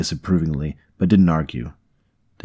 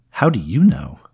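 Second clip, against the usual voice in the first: louder, irritated question with swooping pitch; 3 semitones higher, 5 dB louder than usual. A narrator's reading. Man questioning the woman who chuckled, irritated. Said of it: "the irritation in his voice".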